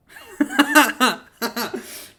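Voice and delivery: loud, high burst of laughter. Real, not acted. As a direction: burst out laughing at your own story. Laughs at the retold remark.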